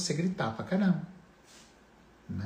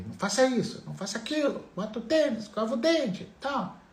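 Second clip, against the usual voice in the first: high-pitched mock string of orders; nagging parent; 11 semitones higher than usual. (pai mandão, voz aguda)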